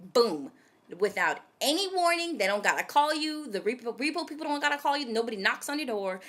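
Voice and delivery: normal talking voice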